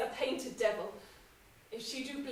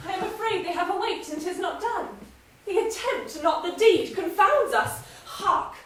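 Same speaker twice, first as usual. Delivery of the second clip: higher, faster anxious rush; agitated fear; 6 semitones higher, 4 dB louder than usual. Acted. Wife fearing the grooms woke, higher and agitated. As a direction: panic that they've woken.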